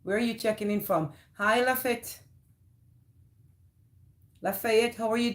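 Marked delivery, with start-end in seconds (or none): none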